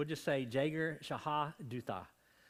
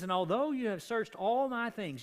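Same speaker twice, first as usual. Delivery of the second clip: indignant; 8 semitones higher, 5 dB louder than usual. Retold or imitated. Jacob confronting Laban.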